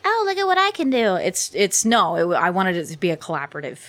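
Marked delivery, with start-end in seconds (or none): 0.0-1.1 high, smug bragging voice; mock show-off. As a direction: play it like a vain showoff. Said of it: "me being like".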